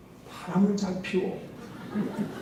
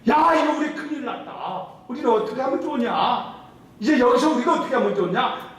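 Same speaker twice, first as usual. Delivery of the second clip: panicked people; 7 semitones higher than usual. Retold or imitated.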